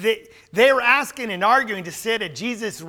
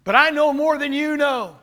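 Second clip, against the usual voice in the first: mock prideful claim; 5 semitones higher than usual. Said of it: "that's a pride issue".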